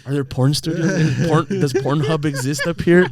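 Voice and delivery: talking through laughter, loud and high; laughing. Real, not acted. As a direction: crack up at your own joke. Cracking up at his own joke.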